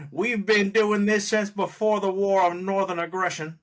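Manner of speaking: put-on Southern accent. Podcaster quoting the auction host, in a mock Southern accent. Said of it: "his terrible Southern accent".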